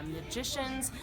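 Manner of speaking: ordinary talk, nothing marked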